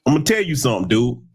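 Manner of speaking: normal talking voice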